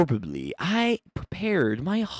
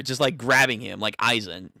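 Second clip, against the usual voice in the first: normal talking voice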